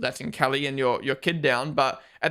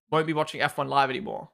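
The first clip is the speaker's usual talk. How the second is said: ordinary talk, nothing marked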